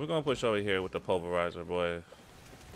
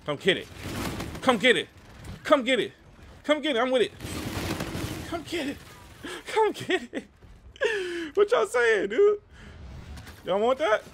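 building from loud to much higher yells; hype taunting; 4 dB louder than usual. Real, not acted. Gamer taunting enemy players mid-fight, rising excited yells. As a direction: taunt them, louder on every repeat. Taunting enemies during kills.

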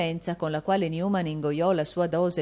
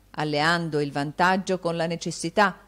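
usual speaking voice

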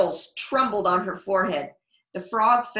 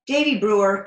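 loud sharp call of his full name; scolding full name; 2 semitones higher, 8 dB louder than usual. Acted. Becky scolding Davy by his full name, loud.

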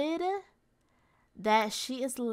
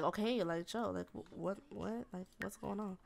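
soft, halting acted confusion; confused boyfriend; 3 semitones lower, 11 dB quieter than usual. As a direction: play him caught off guard, stammering quietly. Acting confused boyfriend, caught out.

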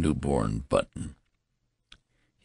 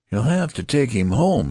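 higher, firmly insisting; insistent; 7 semitones higher, 4 dB louder than usual. A narrator's reading. Nurse ordering the father to take baby home, insistent. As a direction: insist on it, pitched up. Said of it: "insisted the nurse".

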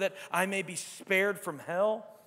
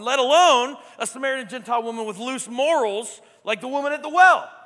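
building emphasis; 3 semitones higher, 9 dB louder than usual. Real, not acted. Repeats phrase, builds louder.

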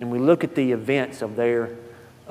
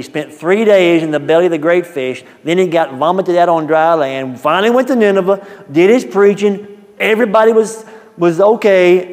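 louder, faster preacher's rise; preaching build; 5 semitones higher, 9 dB louder than usual. Acted. Preacher to congregation, building volume. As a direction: preach it with rising force.

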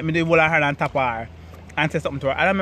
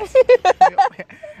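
high, loud burst of laughter; triumphant squealing laugh; gleeful laugh; 8 dB louder than usual. Real, not acted. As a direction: cackle with delight.

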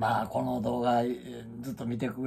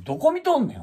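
mock retort; 12 semitones higher, 6 dB louder, faster than usual. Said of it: "思って".

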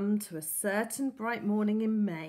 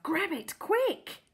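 urgent inner voice; 8 semitones higher, slower than usual. A narrator's reading. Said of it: "he told himself".

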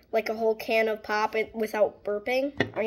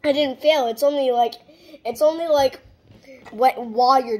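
loud protest; 3 semitones higher, 8 dB louder than usual. Real, not acted. Denies failing the challenge.

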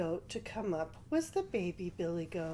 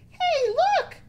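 high, loud little goat cry; littlest billy goat; 10 dB louder than usual. A narrator's reading. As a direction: call out excitedly as the littlest goat. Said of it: "said the littlest billy goat".

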